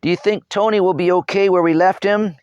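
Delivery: put-on high, loud child voice over the wind. A narrator's reading. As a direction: call it out over the roaring wind. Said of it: "raising his voice above the noise".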